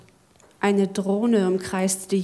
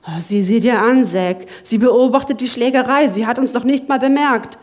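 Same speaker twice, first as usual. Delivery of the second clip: excited; 2 semitones higher, 6 dB louder than usual. Acted. Lachlan eager about the drone.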